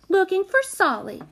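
put-on high-pitched, spirited little-girl reply. A narrator's reading. Small child answering an angry older girl, spirited. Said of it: "said the prodigy spiritedly".

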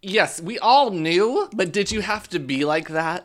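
mock confrontation. Imagined confrontation with old schoolmates, loud high mock indignation. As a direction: play up an indignant, emphatic call-out.

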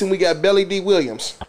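ordinary delivery

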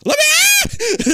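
very high, loud, drawn-out mock scream; imitated scream. Beth trapped behind the mirror, imitated screaming. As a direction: shriek it slowly like someone trapped. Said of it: "hearing Beth scream from the other side".

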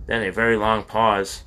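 usual speaking voice

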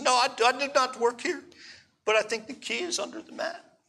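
high, soft Hebrew-accented English, imitated. Upset young lady answering a guest, imitated Hebrew accent. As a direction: mimic an upset Israeli woman with a Hebrew accent. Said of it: "she says with Hebrew accent".

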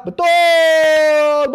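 loud, high betul; excited confirmation. Real, not acted. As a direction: shout approval to the chat. Confirms the chat. (seruan setuju yang keras)

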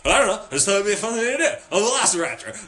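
loud, high, rapid-fire babble; manic excuse. Retold or imitated. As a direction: blurt it out like an overexcited roommate. Roommate's manic excuse acted out.

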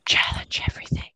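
whispered game slogan; hushed, voiceless tagline; imitated girl whispering. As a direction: whisper it like an eerie game intro. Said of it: "like, girl whispering".

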